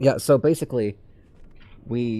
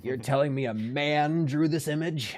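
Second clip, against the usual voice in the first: slow, higher, drawn-out disbelief; exaggerated you're-kidding-me; mock disbelief; 7 semitones higher, slower than usual.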